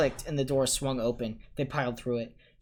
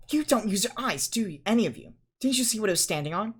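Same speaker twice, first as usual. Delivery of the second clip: high, sharp lecturing tone; know-it-all girl scolding the boys; 10 semitones higher, 6 dB louder than usual. A narrator's reading. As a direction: tell them off. Hermione scolding.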